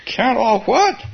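puzzled Pooh. Acted. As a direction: ask it like baffled Pooh. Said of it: "said Pooh".

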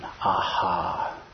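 ordinary delivery

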